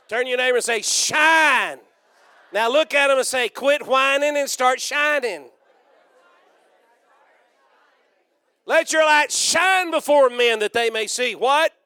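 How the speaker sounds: loud, high, shouted call to the crowd; rally the congregation, loud; call-and-response preaching. Acted. Preacher telling the congregation to shout to neighbors, loud.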